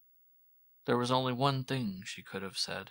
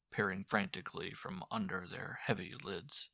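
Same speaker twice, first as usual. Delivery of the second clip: plain reading voice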